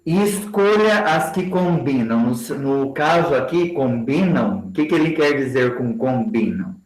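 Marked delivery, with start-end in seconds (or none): none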